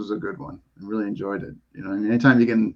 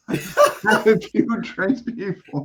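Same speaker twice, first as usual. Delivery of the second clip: laughing; 9 semitones higher, much slower than usual. Real, not acted. Teasing advice through laughter.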